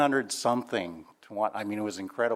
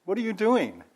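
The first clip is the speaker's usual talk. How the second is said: dad's challenge; 10 semitones higher than usual. Retold or imitated. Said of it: "he goes".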